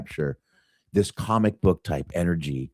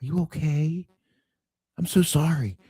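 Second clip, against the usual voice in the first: high apologetic voice; 9 semitones higher, 3 dB quieter than usual. Retold or imitated. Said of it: "he's like".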